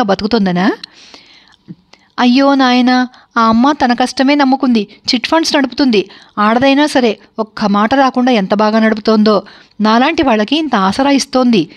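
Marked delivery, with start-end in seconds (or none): none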